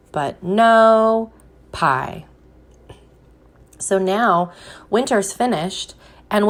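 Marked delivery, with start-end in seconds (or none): 0.1-2.3 slow, lingering but no pie; mock disappointed refrain; drawn-out refrain. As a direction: stretch the refrain out for the kids. Refrain of children's book.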